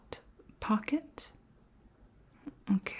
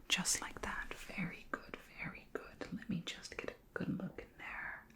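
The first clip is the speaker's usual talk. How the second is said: murmured, breathy praise during a close exam; hushed reassurance; 3 semitones higher, 14 dB quieter than usual. Pretend. Alien examiner looking inside a human's mouth, hushed near-whisper. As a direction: drop to a near-whisper while concentrating.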